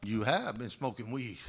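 low, stern accusing father voice. Retold or imitated. Father catching his son, low stern accusation. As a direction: growl the verdict down low.

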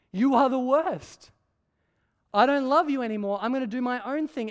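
defiant declaration. Retold or imitated. People defying the living and holy God, defiant and loud. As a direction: throw the words back in defiance. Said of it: "we have said to the living and holy God".

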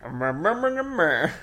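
a hint of grunting through clenched teeth, rising at the end; mock mumble with jaw locked, pitching up. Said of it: "His, his teeth were clenched the fuck shut".

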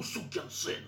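unvoiced hissed insult; hissed curse. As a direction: spit it under the breath.

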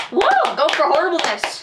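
high, loud whoop; excited cheer. Real, not acted.

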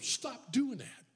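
exasperated wife. Retold or imitated. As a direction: stretch it out like a fed-up wife. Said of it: "She said".

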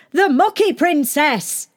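high, slow proclaiming shout. A narrator's reading. Servants hail their mucky princess, shouting. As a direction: proclaim it slowly, high-pitched. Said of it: "shouted the servants and the shepherd boy".

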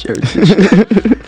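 high, slow stretched-out Heritage; drawn-out mock call. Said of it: "as everybody else was calling it".

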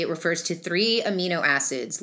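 ordinary talk, nothing marked

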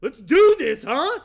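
high, loud rousing shout; rally the room at full volume; loud rallying call. Acted. Rallying the congress loudly.

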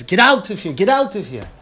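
shouted high and loud, twice; furious rabbi throwing out a missionary; retold scream. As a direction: yell them out of the room. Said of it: "and he screams".

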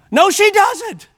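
high-pitched, emphatic denial; incredulous high rebuttal; emphatic contradiction. Real, not acted. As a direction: contradict it with the voice leaping up. Repeats the rebuttal to the congregation.

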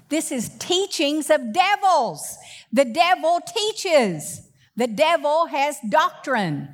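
rising preacher's cry; preaching force. Real, not acted. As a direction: drive the warning home, slowing on the devil. Preaching climax on devils' doctrine.

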